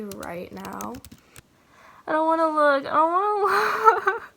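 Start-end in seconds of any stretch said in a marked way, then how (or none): 2.1-2.9 nervous dread. Real, not acted. Nervous before opening admission result. 2.9-4.2 high, laughing through the words; giggling with real nerves; nervous giggle mid-word. Real, not acted. Nervous before opening admission result.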